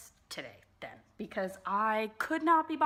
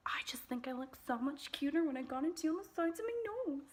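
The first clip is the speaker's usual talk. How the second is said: breathy confiding whisper; 6 semitones higher than usual. Real, not acted. Confessing to viewers her nose contour trick, whispered. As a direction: whisper it like a little secret.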